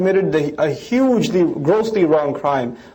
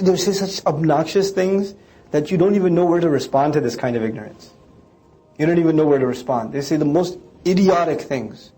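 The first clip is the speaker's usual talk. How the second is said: usual speaking voice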